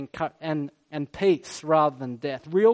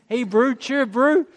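mock Kiwi greeting, pitched up; Kiwi accent; 4 semitones higher than usual. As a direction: imitate a chummy Kiwi accent, rising at the end. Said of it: "You hear the Kiwi say".

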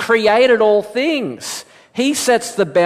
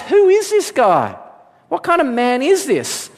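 much higher, amazed disbelief; astonished disciples; 8 semitones higher than usual. Retold or imitated. The disciples marvel at Jesus after the storm, pitched high in awe. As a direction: gasp it out in wonder.

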